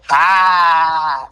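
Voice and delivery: one loud, drawn-out "haaa"; long stupid laugh. Shown as a demonstration. Comic showing his old gag laugh, long and stupid. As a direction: hold a single silly laugh note. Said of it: "It was just a long laugh".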